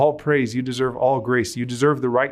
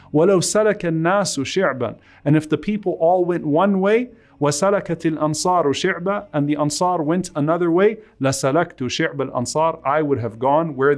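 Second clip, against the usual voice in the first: emotional preaching; 4 semitones higher, much faster than usual. Acted. Prophet's oath of loyalty, emotional.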